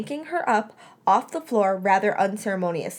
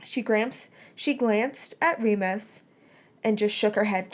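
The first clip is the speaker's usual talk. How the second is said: usual speaking voice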